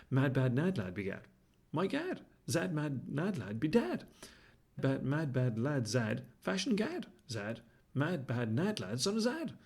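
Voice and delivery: slow, deliberate rhythmic tongue twister. Acted. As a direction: chant the twister word by word.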